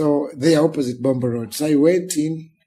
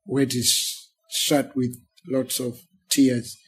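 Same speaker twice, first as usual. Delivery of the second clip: ordinary talk, nothing marked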